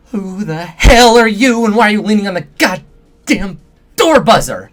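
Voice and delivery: high, loud, wild-eyed shriek of a wired man; a strung-out techie yelling, high and loud; shrill tremulous voice. Acted. Doorman shrieking at stranger leaning on buzzer, high loud yell. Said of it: "The voice has a shrill, tremulous quality".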